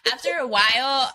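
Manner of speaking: ordinary talk, nothing marked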